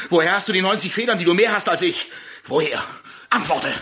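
fast, high and loud, spitting the questions out; furious accusation. Acted. Jaime accusing his comrade of hiding feathers, furious fast shouting. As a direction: hammer him with the accusations, rushing.

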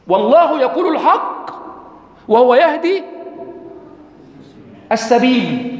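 slow chanted verse, drawn out; slow recitation. Shown as a demonstration. Preacher recites a verse to the congregation, slow and drawn out. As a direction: recite the verse slowly and devoutly.

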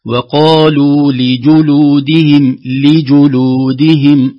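slow "لجلودهم", clear kasra then clear damma; correct vowels. Shown as a demonstration. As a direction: say "لجلودهم" slowly and fully. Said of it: "نكسر اللام محققة ثم نضم الجيم". ("لجلودهم" بكسرة ثم ضمة محققتين)

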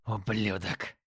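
growling. Acted. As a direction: growl it through the pain. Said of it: "прорычал Дэвид".

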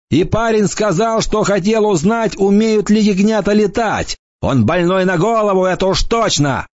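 farmer's accusing tale, climbing high; indignant farmer. A narrator's reading. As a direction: build to a high, outraged accusation.

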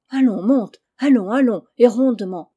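loud urging. Acted. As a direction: hurry him up with a loud call. Master urges Jupiter to climb.

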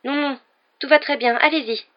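put-on very high little girl's voice; small high voice. A narrator's reading. Hermione reassures the boys from her cabin, high and piping. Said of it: "une petite voix aiguë".

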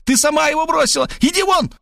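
put-on high girlish voice, fast, throwing her out; girl shouting. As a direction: order her out, high and quick. Girl drives rival out. (высокий быстрый девичий крик)

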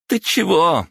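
sharp, high, loud outburst. Acted. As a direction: snap back indignantly. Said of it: "на повышенных тонах говорила". (на повышенных тонах)

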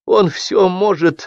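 high, halting, drawn-out stammer; forced out, crushed. A narrator's reading. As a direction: squeeze the words out, beaten. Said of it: "выдавил из себя прибитый Кытин".

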